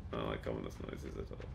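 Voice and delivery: very low, hushed mutter; under the breath. Real, not acted. Player uneasy about ominous noises, muttering very low. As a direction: mumble it softly to yourself.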